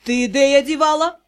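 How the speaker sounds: usual speaking voice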